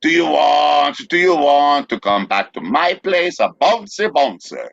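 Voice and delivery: imitating the video speaker. Reading out the slang from a video, high exaggerated imitation. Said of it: "the way the person said it was".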